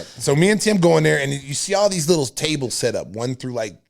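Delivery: ordinary talk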